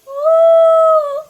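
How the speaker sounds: loud high ghost whoop; ghostly woo. Pretend. (malakas na multong "woo")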